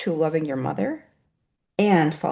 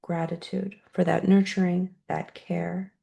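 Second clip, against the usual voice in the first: guided meditation. Acted. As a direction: guide the room gently into gratitude. Leads a closing gratitude meditation.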